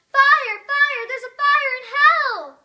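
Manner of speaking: full-force high shout, drawn out at the end; yelled alarm. Acted. Daydreaming of rising in church, yelling an alarm. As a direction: yell it like a fire alarm to the whole church. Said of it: "rise to my feet and yell".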